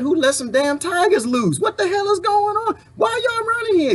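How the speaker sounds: high-pitched, indignant mock shout; alarmed outcry. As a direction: yell it like tigers just got loose. Acted outcry at loose tigers.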